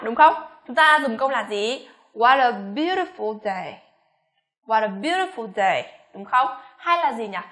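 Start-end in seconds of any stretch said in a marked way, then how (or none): 2.1-3.8 slow exclamation, "day" falling; falling intonation. Shown as a demonstration. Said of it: "phải xuống giọng cuối câu". 4.7-6.0 slow exclamation descending to "day"; falling intonation. Shown as a demonstration. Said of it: "phải xuống giọng cuối câu".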